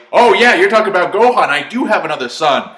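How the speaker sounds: loud, high-pitched mock epiphany; Goku's sudden realization. Goku finally remembering his other son, loudly amazed. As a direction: blurt it out like a clueless dad.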